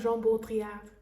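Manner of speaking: a touch of French flair on "Jean Baudrillard"; French accent. Shown as a demonstration. Host introduces the French sociologist, in a light French accent. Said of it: "I said that with a French accent".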